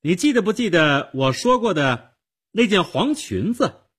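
put-on high, loud girl's voice; calling down. A narrator's reading. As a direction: project it down to the cellar. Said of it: "他对着下面的地窖说". (朝地窖高声说)

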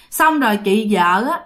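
usual speaking voice

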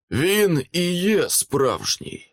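high, drawn-out bored delivery. A narrator's reading. Man in costume answers a remark about him, bored. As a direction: stretch it out slowly, as if bored. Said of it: "сказав чоловік нудним тоном".

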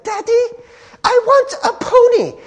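put-on high, loud demanding little girl voice; spoiled British brat. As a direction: whine like a spoiled rich kid, badly faked British. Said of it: "That's my British accent".

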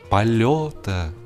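plain reading voice

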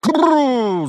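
loud, high rolled "prrr"; yelled trill. A narrator's reading. Driver yelling at his oxen, piercing trill. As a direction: holler a long rolled whoa. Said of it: "заорал голос".

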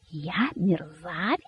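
soft, slow, stunned question; hushed, drawn-out incredulous anger; speechless with anger. A narrator's reading. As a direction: repeat it slowly, choked back with outrage. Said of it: "не находя слов от гнева".

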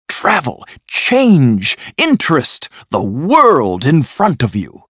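building, high-pitched enthusiasm; rapturous cry. A narrator's reading. Toad sells his friends the open road, rapturous and building. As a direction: list each wonder with mounting excitement. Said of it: "cried the Toad".

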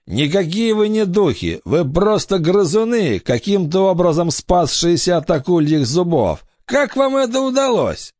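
high-pitched scoffing pirate; disbelieving pirate. A narrator's reading. Pirate doubts the children are ghosts, scoffing high voice. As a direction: challenge them in a high, incredulous pirate voice.

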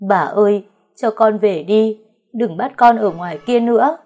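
giọng nghẹn ngào van xin; choked-up pleading. A narrator's reading. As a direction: beg grandmother through held-back sobs. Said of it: "nghẹn ngào nói".

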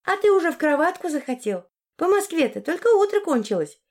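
high-pitched mocking tease; snide question. A narrator's reading. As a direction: needle him in a high, sarcastic voice. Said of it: "ехидно спросила Арина".

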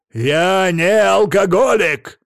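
loud, high, slowly stretched shout; outraged drawn-out bellow; indignant yell. A narrator's reading. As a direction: roar your denial word by word. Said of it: "заорал".

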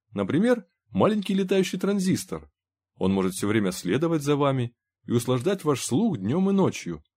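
high-pitched, lilting sales pitch; eager salesman. A narrator's reading. Trader offering goods to a silent armed customer, bright salesman's patter. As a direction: coax the customer with polite eagerness.

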